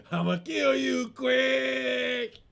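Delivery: mock wailing threat, name drawn out; imitated crying shout, stretched at the end; crying guy. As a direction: wail it out like a hammed-up crier. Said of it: "Arsenio Hall as the crying guy".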